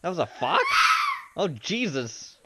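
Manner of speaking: can't believe it was a fox; shocked shout at the fox's scream; stunned. Real, not acted.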